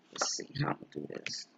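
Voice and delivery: unvoiced mutter to herself; whispered to herself. Real, not acted. Murmuring to herself while checking the setup, whispered. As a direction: whisper it under your breath.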